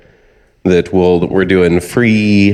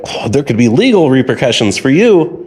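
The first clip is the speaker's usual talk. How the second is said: raised, mock-ominous warning; lightly acted scammer scare tactic, pitched up; alarmed scammer; 7 semitones higher than usual. Scammer threatens the old caller with legal trouble, mock alarm.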